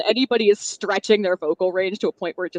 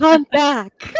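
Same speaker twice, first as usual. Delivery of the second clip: mock audiobook drama; 8 semitones higher, 5 dB louder than usual. Mimicking audiobook after growled line.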